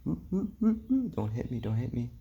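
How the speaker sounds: high, hammed-up panic while dodging cars; mock panic. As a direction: squeal like you're dodging traffic. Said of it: "You're just like".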